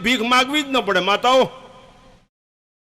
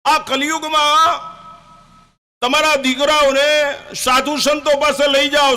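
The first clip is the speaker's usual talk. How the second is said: calling out; 4 semitones higher than usual. Real, not acted. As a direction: raise the voice and stretch each word. Preacher calls out warning. (ધીમો, ઊંચા અવાજે ચેતવણીનો પોકાર)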